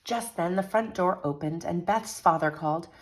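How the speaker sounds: lively picture-book read-aloud; animated storytelling to children; story narration. A narrator's reading. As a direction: read it like a bedtime story for kids.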